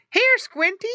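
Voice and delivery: high, loud call to a piglet. A narrator's reading. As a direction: call Squinty over to the trough. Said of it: "called the farmer's wife".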